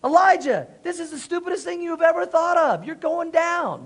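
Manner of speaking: high, gloating trash talk; cocky prophets. Acted. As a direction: crow as if victory is already yours. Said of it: "They're like".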